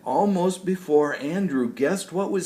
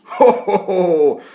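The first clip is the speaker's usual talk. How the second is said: loud, jolly ho-ho-ho; hearty ho ho laugh; 4 semitones higher, 9 dB louder than usual. Acted. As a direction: laugh like a big happy old tree.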